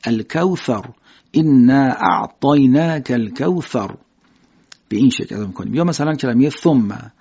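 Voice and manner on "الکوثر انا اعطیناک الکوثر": slow, blown ث in الکوثر; Arabic tha. Shown as a demonstration. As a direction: air through the teeth on الکوثر.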